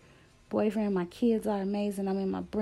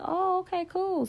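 very high, airy retold "oh okay cool"; retelling her answer to the realtor, pitched high; mock casual reply; 10 semitones higher than usual. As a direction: toss it off up high, playing it cool.

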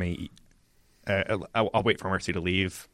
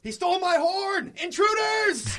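high, slow alarm yell; yelling for help; slower than usual. Acted. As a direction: shout it to raise the alarm. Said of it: "I'll yell as loud as I can".

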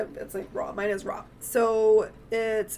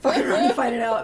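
fast and loud, laughing through it; 7 dB louder, much faster than usual. Real, not acted. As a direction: blurt it out with a laugh. Repeating the podcast tagline.

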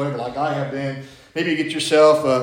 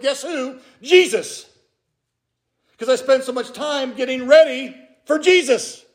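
higher, rising pulpit voice; earnest sermon delivery, pitched up; preaching; 9 semitones higher, 3 dB louder than usual. Acted. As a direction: drive the point home to the pews. Preaching to the congregation.

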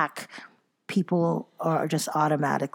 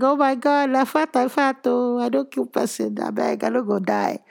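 Nigerian Pidgin; 7 semitones higher than usual. Retold or imitated. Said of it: "I was like ... Nigerian slang".

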